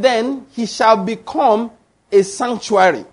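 "Then he shall become a sanctuary" read slowly, each word weighted; slow, weighty scripture emphasis; slow emphatic reading. Shown as a demonstration. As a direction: lean on "shall" and draw out "sanctuary". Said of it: "Please, I want you to understand that".

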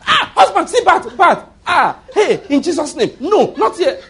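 high, loud, panicked mock squeal; hammed-up fright over a bat; fear. Other wives screaming to their husbands about a bat, mock panic.

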